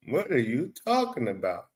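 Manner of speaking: normal talking voice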